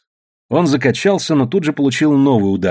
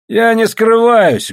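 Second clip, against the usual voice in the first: loud, high, slow proud declaration; proud reply; 11 semitones higher, 5 dB louder, much slower than usual. A narrator's reading. Андрюшка answering his pursuer, proud and loud. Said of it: "гордо ответил Андрюшка".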